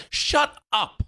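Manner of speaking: sudden high, sharp cut-off; angry snap. Real, not acted. Boss cutting off the talent, sharp and angry. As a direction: shut him down hard. Angry outburst mid-argument.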